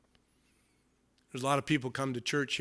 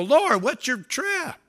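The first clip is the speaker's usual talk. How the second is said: higher, slow, laughing disbelief; disciples scoffing; 6 semitones higher, 8 dB louder, slower than usual. Retold or imitated. Disciples to Jesus, amused disbelief. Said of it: "they go".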